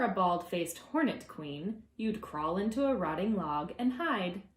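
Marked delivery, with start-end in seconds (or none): none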